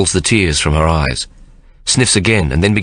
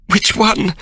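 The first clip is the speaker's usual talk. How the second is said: much higher, flustered cry; sudden panic. A narrator's reading. Tearful Sarah unsure which finger, panicked. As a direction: panic as if you've forgotten something basic. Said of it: "cries in a sudden panic".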